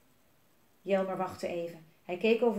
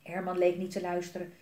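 usual speaking voice

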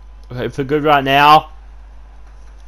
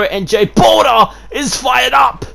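hyped shout; 6 semitones higher than usual. Real, not acted. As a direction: fire the crowd up. Commentator hypes wrestler.

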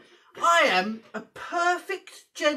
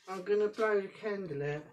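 ordinary talk, nothing marked

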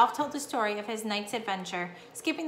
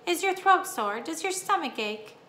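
higher-pitched, mildly worried questions; anxious; 6 semitones higher than usual. A narrator's reading. Mother checking on her sick son, anxious. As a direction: ask with a touch of motherly worry. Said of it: "asked anxiously".

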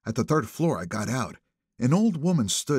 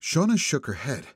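ordinary delivery, nothing marked

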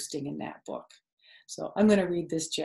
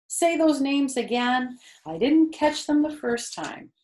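higher, louder eager Wilbur; the pig asking keenly, pitched up; 8 semitones higher, 5 dB louder than usual. Acted. Wilbur begging Charlotte to repeat, higher and eager. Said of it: "Wilbur sat bolt upright".

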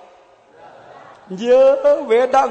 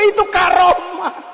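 a slow, louder, higher call out to the crowd; preaching shout; 8 semitones higher, 3 dB louder, slower than usual. Real, not acted. Preacher calls out to the congregation in a slow, raised shout. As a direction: drive the point home to the congregation. Preaching about divine favor.

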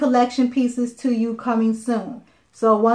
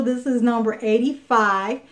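ordinary talk, nothing marked

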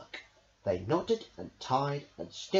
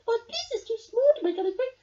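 sniffing; 6 dB louder, faster than usual. Acted. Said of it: "Mouse sniffed".

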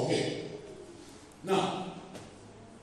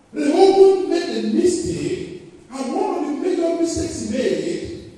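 high, loud preacher's build; preaching at full force; fervent preaching; 10 semitones higher, 6 dB louder than usual. Acted. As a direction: proclaim it to the church.

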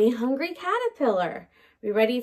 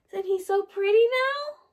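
delighted; 8 semitones higher than usual. Acted. Admiring the butterfly for kids.

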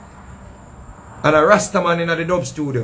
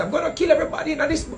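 fast, much higher, rushed panic; frantic outburst; 10 semitones higher, 4 dB quieter, faster than usual. Retold or imitated. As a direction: blurt it out in a high, fast panic. Retold threat in the car.

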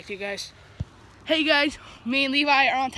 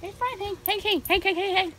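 higher, rapid calls to the dog; higher coaxing dog calls; calling the dog; 6 semitones higher than usual. Real, not acted. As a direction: chant his name up high to settle him. Calming the excited dog.

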